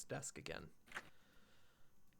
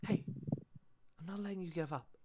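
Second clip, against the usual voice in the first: lightly voiced, higher encouraging student; gently insist, with slightly raised pitch; Marissa; 5 semitones higher, faster than usual. Friend stopping Adam from giving up, lightly voiced encouragement. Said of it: "hear the voice of Marissa".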